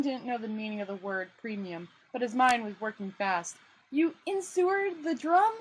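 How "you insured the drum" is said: high, slow disbelieving question; incredulous. Acted. Rincewind stunned to hear Two Flower insured the drum. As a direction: drag it out in disbelief.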